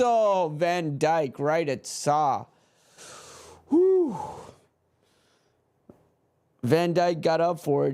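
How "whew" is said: high, sharp whew of relief; a fan's whew after the goal survives; relieved whew. Real, not acted. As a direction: exhale the near miss away. Near miss at his goal.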